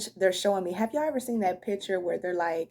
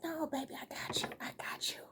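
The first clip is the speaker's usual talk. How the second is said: soft whisper; 8 semitones higher, 13 dB quieter than usual. Retold or imitated. Guides comfort the broken listener.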